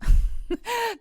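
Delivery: sudden high laugh bursting out; burst of laughter. Real, not acted. Reader cracking up at her own aside. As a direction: laugh out loud at herself.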